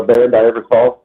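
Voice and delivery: ordinary delivery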